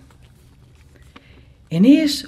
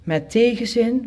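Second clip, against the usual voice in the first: ordinary delivery, nothing marked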